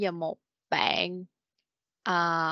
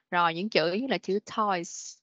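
usual speaking voice

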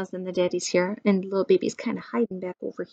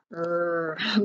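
grumbling. A narrator's reading. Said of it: "grumbled the pandas".

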